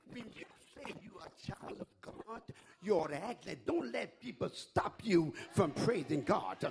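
ordinary delivery

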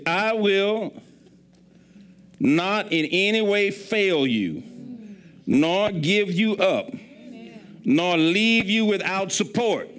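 each phrase drawn out and weighty; solemn slow scripture reading; slow emphatic preaching. Acted. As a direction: lay out the promise slowly, word by word. Everything God says is for a purpose.

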